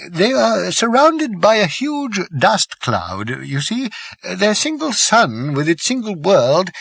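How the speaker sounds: put-on a wise old planet-builder explaining gravely; elderly Slartibartfast.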